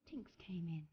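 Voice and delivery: hushed whisper, breathless suspense; whispered reveal. Acted. Storyteller whispering to children that Tinkerbell came in. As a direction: drop to a secret whisper.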